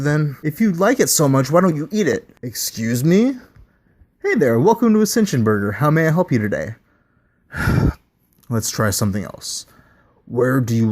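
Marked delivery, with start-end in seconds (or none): 4.2-6.8 high, fast, chirpy service-counter pitch; perky drive-thru voice; cheery fast-food greeter. Acted. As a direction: beam the scripted welcome at the customer. Greeting restarted on a loop.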